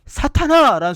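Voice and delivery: shouted rebuke. Retold or imitated. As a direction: call it out as a sharp rebuke. Quoting Jesus' sharp rebuke of Peter.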